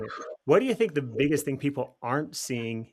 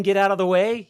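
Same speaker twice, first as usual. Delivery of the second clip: loud, raised-pitch mock warning shout; yelling; 6 semitones higher, 9 dB louder than usual. People yelling at someone walking backwards into the future, mock shout. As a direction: yell it like clearing a path. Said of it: "Those people yelling".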